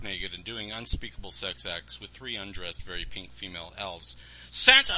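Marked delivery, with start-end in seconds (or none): 4.5-4.9 high, loud shocked scream. Acted. Boy catches Santa in the act, shocked scream. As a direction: shriek his name in horror. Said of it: "I screamed".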